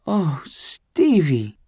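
drawn out like a mother melting; tender sigh. A narrator's reading. A mother touched by her son's kindness, slow and tender. Mom moved by son's gesture.

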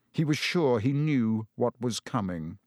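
usual speaking voice

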